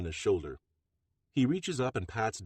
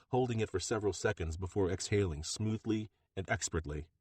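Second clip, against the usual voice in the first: usual speaking voice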